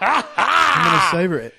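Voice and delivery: excited. Real, not acted. Hot sauce challenge bravado.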